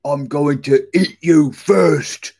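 loud, slow troll threat; growling troll. A narrator's reading. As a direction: threaten to gobble them up, slowly. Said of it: "growled the troll".

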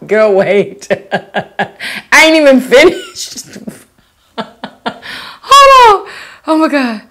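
can't stop laughing, fighting to talk; cracking up mid-read at a funny comment. Real, not acted.

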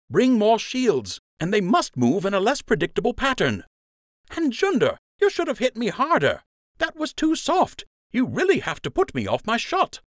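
put-on high, tense voice of a driven young buck; impatient young archer. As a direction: push hard, frustrated perfectionist. Nate demands faster drills.

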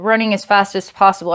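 fast, breathless-paced repeat; rushed repeat. Shown as a demonstration. Reader speeds up to echo the running. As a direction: rush it like you're running.

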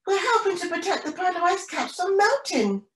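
high-pitched storybook call; shouting back. A narrator's reading. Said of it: "Penny shouts back".

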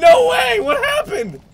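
high loud disbelieving cry; shocked yell. Real, not acted. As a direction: shout in shock at losing. Loses the round suddenly.

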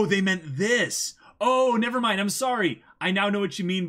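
loud, high, tumbling apology as it dawns; excited realization. Real, not acted. Realizing what chat meant, loudly excited and apologetic. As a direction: blurt it out, delighted and sheepish.

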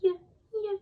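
very high, light fairy voice; tiny tinkling fairy voice. Shown as a demonstration. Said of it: "Can you say it in a fairy voice?".

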